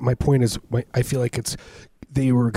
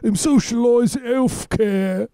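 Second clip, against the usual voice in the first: much higher, put-on voice as a mock Canadian; bad Canadian impression; 13 semitones higher, slower than usual. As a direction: ham up a wrong-sounding Canadian accent, calling out. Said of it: "doing a Canadian impersonation".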